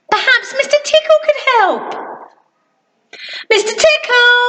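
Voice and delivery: high, bright call; Little Miss Sunshine. Acted. Little Miss Sunshine calls Mister Tickle, high eager cry. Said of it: "she cried".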